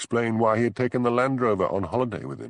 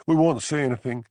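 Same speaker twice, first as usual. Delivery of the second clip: higher, louder frightened plea; pleading cry; 6 semitones higher, 4 dB louder than usual. A narrator's reading. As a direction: beg for your life. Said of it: "cried the woman".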